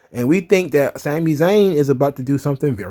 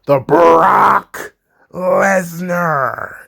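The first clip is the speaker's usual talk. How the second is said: drawn-out hype announcer impression; mock ring introduction; much slower than usual. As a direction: stretch both names, rolling into them like an announcer. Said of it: "he starts going into the".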